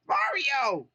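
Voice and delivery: very high, loud joyful cry; rescued Toad crying out happily; cried happily. Acted. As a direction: squeal his name with joy. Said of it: "he cried happily".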